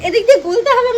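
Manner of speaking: mock his odd phrase through a laugh; laughing repeat. Wife echoes husband's word laughing, amused. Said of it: "এদিক দিয়ে গলা আবার কি কথা".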